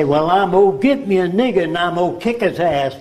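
lightly put-on Southern accent; a hint of a Southern good old boy. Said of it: "you speak with a Southern accent".

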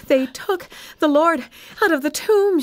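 high, breathless alarm; gasping. A narrator's reading. Mary to Peter and John after running, gasped. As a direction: gasp it out after running hard. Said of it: "she gasped".

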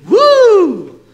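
high, loud "woo"; excited whoop. Acted. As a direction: whoop over the dream house. Cheering over a nice house.

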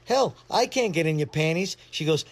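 ordinary delivery

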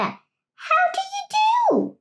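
very high duck voice, lifted way up; duck greeting. Acted. Duck stopping the rat on the road, very high duck voice.

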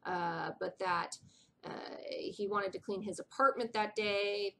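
ordinary talk, nothing marked

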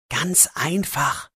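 soft, slow whisper. Acted. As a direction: whisper it so no one hears. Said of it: "sagt Max leise". (leise geflüstert)

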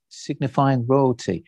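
ordinary delivery

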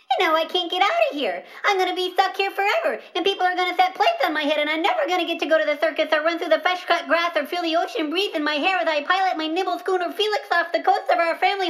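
fast, breathless worried babble; panicked rambling. Acted. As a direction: rush it out in a panic. Panicked rambling about being stuck.

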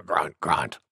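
low, soft boar grunts; low storybook pig voice; wild boar grunt. Acted. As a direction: grunt it deep after a sneeze. Wild Boar grunting after sneeze.

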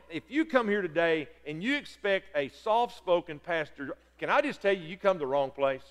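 ordinary delivery

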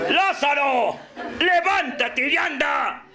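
high, slow commanding holler; calling shout. Acted. Jesus ordering Lazarus to rise, shouted command. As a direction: call the dead man out, drawing it out. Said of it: "le grita".

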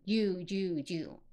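"you" fused with d into a "joo" sound; linked d and y. Shown as a demonstration. Teacher showing d meeting y, linked "joo". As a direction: slowly repeat "you" as "joo". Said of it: "D和Y在一起".

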